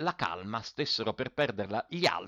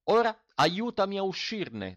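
a higher, pleading call from inside the jar; old mender asking for help; 10 semitones higher, 3 dB louder than usual. A narrator's reading. Mender begs the farmhand to get him out, raised plea. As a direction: ask to be pulled out. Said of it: "disse alla fine Zi Dima".

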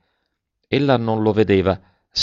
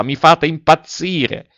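high, loud and slow, losing control; frantic cry; 8 semitones higher, 5 dB louder, slower than usual. A narrator's reading. Alberto to silent Velleda, frantic cry. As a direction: cry out as if going mad. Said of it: "gridò delirante".

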